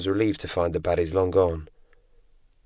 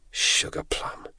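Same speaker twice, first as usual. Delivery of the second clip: soft, voiceless whisper; hushed whispered echo; 6 dB quieter, much slower than usual. A narrator's reading. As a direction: whisper the pet name under your breath. Name repeated in a whisper.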